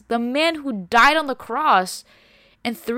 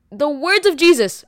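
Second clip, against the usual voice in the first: louder, driving home the point; loud emphasis; 6 semitones higher, 8 dB louder than usual. Real, not acted. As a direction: hammer the words of Jesus. Preacher stresses Jesus's words.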